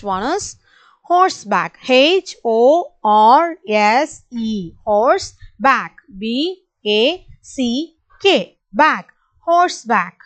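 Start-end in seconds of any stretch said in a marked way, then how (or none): 1.9-8.6 each letter slow and spaced; slow spelling. Shown as a demonstration. Teacher spelling slowly for the class. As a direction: spell slowly so kids can follow. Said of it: "repeat along with me".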